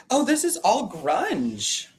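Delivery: normal talking voice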